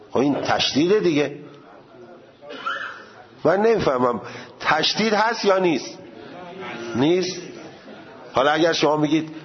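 normal talking voice

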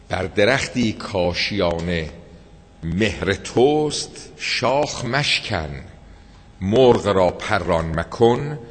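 slow poem recital. Acted. Preacher quoting a poet, slow recital. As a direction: let each line hang slowly. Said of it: "به قول اون شاعر".

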